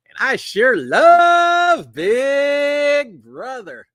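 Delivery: very slow, loud and high, stretching every word; hammed-up gush. Real, not acted. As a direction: gush over the show like a fan showing off. Hammed-up love for the show.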